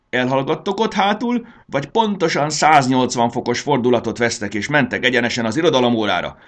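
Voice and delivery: loud, high-pitched teacher's scolding; strict teacher roaring at pupils; angry bellow. A narrator's reading. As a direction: shout down the back row, threateningly. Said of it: "rivalt rájuk a szögmérő".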